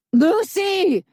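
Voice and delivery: high loud call from the shadows; distant voice calling from the shadows. A narrator's reading. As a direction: call her name from the dark. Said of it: "a voice called out".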